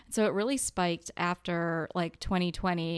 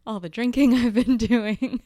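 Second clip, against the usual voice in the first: laughing; 5 semitones higher, 7 dB louder than usual. Real, not acted. Laughing about drinking.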